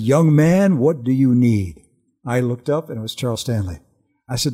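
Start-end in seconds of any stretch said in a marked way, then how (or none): 0.0-1.8 louder, slow Southern drawl imitating the pastor; Georgia drawl. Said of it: "that awesome Georgia Southern voice said".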